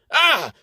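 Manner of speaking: a sudden high, loud yelp of fright; startled cry. A narrator's reading. Caught hiding, Lynx lets out a high, loud startled yelp. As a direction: jump at being caught. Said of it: "The surprise of getting caught".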